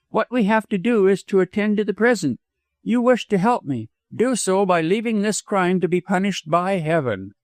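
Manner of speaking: high-pitched, insistent woman; petulant widow. A narrator's reading. Widow urges doctor to drop the crime, insistent. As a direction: press him to heed you, pitched high.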